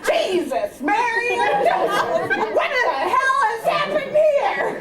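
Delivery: rising, loud mock outrage; hammed-up outraged mom; shocked mother. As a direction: exclaim over the broken figurine. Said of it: "Ma stood there surveying".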